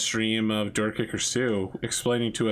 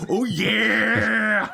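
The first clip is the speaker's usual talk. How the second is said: high screaming wrestler-dragon roar; Macho Man Randy Savage; 9 semitones higher, 4 dB louder than usual. Acted. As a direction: belt out the wrestler's catchphrase, high and drawn out. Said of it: "when they scream at you, instead it goes".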